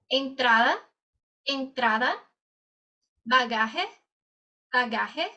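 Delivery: slow, clear drill words with pauses; repeat-after-me drill. Shown as a demonstration. Teacher leading students in a repeat drill. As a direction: model each word for echoing. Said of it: "repitas conmigo".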